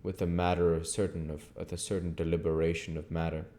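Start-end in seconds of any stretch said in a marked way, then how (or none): none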